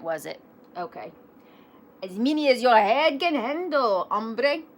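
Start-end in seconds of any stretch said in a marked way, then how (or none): 2.0-4.6 second try at a hammed Spanish accent. A narrator's reading. Kitty boy answering his reflection, hammed Spanish accent. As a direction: put on an amateur Spanish accent, pitched up. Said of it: "very best Spanish accent".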